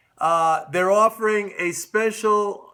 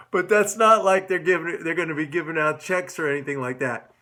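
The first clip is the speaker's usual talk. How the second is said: ordinary talk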